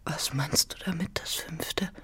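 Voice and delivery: unvoiced whispered question. Anna whispering to Manao about the fifth child. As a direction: whisper it, afraid to ask.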